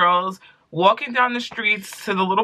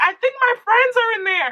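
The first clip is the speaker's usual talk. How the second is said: high-pitched quoted voice. Retold or imitated. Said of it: "and I'm like".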